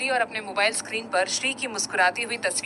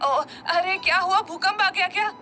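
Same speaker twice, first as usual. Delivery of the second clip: startled, much higher panicky cry; jolted awake after falling off the bed; panicked; 8 semitones higher than usual. A narrator's reading. Brother fallen off the bed, waking up in startled panic. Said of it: "घबराते हुए बोला".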